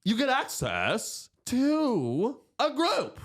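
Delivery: very slow, high announcer voice; drawn-out radio host read; broadcaster voice. Shown as a demonstration. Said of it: "I'm trying to learn to have a broadcaster's voice".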